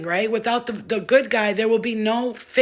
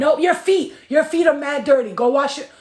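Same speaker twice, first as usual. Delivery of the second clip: raised voice, firm mom; loud scolding; 5 semitones higher, 5 dB louder than usual. Real, not acted. Mom telling son his feet are dirty, loud scolding. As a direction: send him off to wash.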